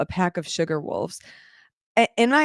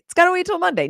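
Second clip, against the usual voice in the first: much higher and louder, a mock outburst; high, loud mock protest; high loud exclamation; 11 semitones higher, 4 dB louder, much faster than usual. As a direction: blurt it out in exasperation. Repeats the line two ways.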